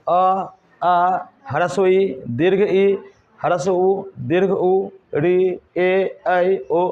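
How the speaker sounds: chanting "अ, आ, ह्रस्व ई" slowly, one vowel at a time; slow vowel recitation. Shown as a demonstration. Teacher reading the vowel list aloud to students, slowly chanted. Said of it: "ज़रा पढ़ना तो".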